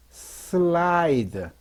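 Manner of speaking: 슬라이드 stretched slowly, sound by sound; slow, elongated model word; drawn-out demonstration. Shown as a demonstration. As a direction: draw 슬라이드 right out for learners.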